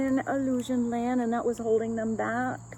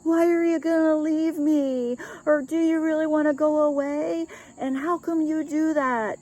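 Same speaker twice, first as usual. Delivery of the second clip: put-on higher, louder, pleading girlfriend voice; whiny girlfriend; 6 semitones higher, 5 dB louder than usual. Former girlfriend protesting to her boyfriend about leaving, high and whiny. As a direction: act out the ex begging him not to leave. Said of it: "The former girlfriend said".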